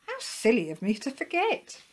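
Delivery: eager upward-ending dog voice; cheerful self-reproach. A narrator's reading. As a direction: laugh off your own forgetfulness. Willie chides himself happily.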